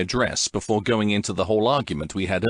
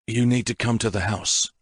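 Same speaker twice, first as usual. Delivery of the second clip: ordinary delivery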